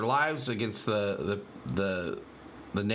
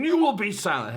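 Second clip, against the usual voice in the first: higher, louder stern order; mock angry command; 10 semitones higher, 6 dB louder than usual. Fan imitating Bortus silencing someone, angry. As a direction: bark it like a furious alien officer. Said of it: "being extremely angry and saying".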